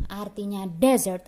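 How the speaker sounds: ordinary talk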